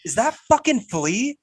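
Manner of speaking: high, disbelieving blurt; retold surprise. Repeating the moment of recognizing [name], surprised. Repeats own startled reaction for listener.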